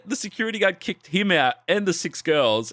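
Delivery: ordinary talk, nothing marked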